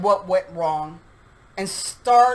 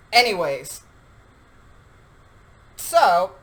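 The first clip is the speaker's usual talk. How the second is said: ordinary delivery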